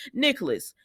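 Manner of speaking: normal talking voice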